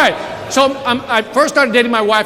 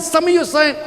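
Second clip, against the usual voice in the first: ordinary talk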